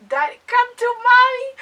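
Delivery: high, loud playful temptress voice; seductive purr. Retold or imitated. Narrator voicing her inner flirt toward the nervous guy, hammed-up. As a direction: lure him in, hammed-up vamp.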